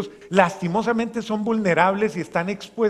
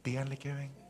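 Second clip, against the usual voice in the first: gentle command; 3 semitones lower, 12 dB quieter than usual. Acted. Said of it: "Jesús dice".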